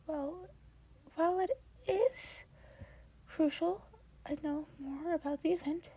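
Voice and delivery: bright eager offer from a cheerful mentor; Nezu's voice. Acted. Nezu voiced by reader.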